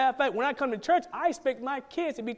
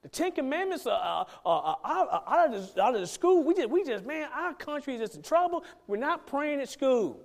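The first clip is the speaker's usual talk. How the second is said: higher, worked-up grumbling about school prayer; higher mock lament, slowing at the end; complaining churchgoer; 5 semitones higher than usual. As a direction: act a frustrated believer lamenting the country. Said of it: "Christians, say".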